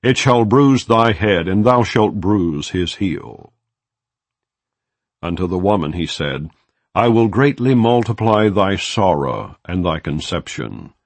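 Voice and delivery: ordinary delivery, nothing marked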